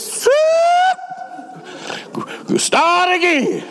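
high barked order, drawn-out start; coach's shout. Retold or imitated. Coach yelling at worn-out players to restart. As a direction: yell it like a coach at the line. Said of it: "and he says".

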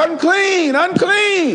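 a warning cry at people who come near; hollered warning. Retold or imitated. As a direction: call it out so people keep away. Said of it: "you had to holler out".

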